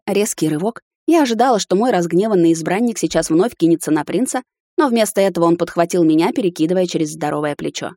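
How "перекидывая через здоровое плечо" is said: plain reading voice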